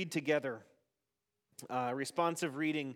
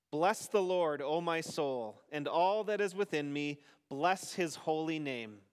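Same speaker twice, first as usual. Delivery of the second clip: responsive psalm reading. Acted. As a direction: lead the psalm and leave room for the congregation.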